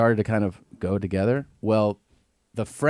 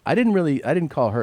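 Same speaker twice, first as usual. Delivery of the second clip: retold defensive denial; 6 semitones higher than usual. Retold denial to an angry son.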